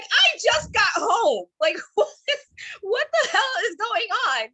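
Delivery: high, slow, laughing disbelief; reacting to mom's stabbing news with high, laughing shock. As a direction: play it stunned and half laughing.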